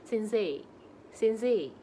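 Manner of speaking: "Sensei" ending in a long e, not ei; "Sensei" said as sensee; long final e. Shown as a demonstration. Teacher modelling "Sensei" with a long e. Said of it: "dipanjangnya aja".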